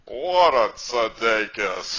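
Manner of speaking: slow, loud, drawn-out gush; bursting admiration. Retold or imitated. Older lady at the class gushing over the neighbor, slow and loud. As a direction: stretch it out like she can't hold it in. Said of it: "she couldn't contain herself".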